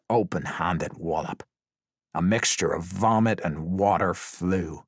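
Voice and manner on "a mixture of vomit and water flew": usual speaking voice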